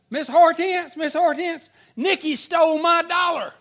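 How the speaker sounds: high, loud mock accusation; shrill-pitched tattletale calling out to teacher; tattling schoolgirl. Classmate tattles to teacher, acted.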